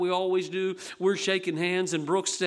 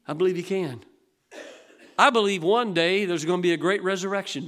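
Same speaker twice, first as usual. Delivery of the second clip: ordinary talk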